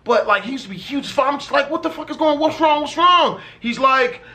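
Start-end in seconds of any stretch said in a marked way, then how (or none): 1.7-3.4 alarmed. Retold or imitated. Asking crying brother what happened.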